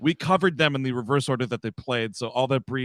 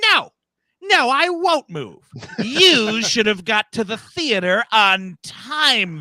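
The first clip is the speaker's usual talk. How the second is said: very high, loud, slow indignant scream; screaming lady; 9 semitones higher, 7 dB louder, slower than usual. Retold or imitated. Lady yelling at the man across the theater, high and slow. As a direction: shriek it from the back row. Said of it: "you just hear this, like".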